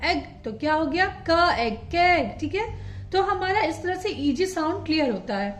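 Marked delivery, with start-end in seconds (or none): 1.3-2.4 प, एग, पेग sounded out slowly; slow teacher blending. Shown as a demonstration. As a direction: build पेग piece by piece. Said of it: "के का phonic sound होता है क".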